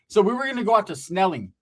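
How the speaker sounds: ordinary delivery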